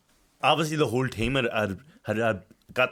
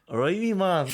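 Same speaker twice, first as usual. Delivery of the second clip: higher, put-on Scottish accent; 8 semitones higher than usual. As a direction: greet him like a cocky Scottish lad. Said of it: "Do your Scottish accent".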